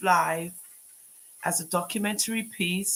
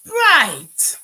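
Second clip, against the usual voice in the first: loud, higher snap of "Right."; a real flash of anger; sharp angry retort; 9 semitones higher, 11 dB louder than usual. Real, not acted. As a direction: bite back at the troll.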